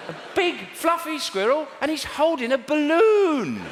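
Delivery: very high, jeering disbelief; mock it mercilessly, stretching out the last words; heckler put-down. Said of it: "treating the kids like hecklers".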